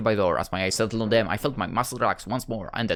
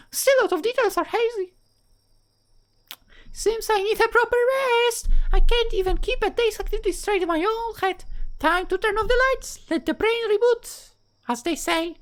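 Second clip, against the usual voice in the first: put-on high-pitched girl voice, much higher than his own; Rebecca's voice; 3 dB louder than usual. Rebecca greets Arthur, high-pitched girl voice. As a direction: play a cheerful young girl in a high falsetto.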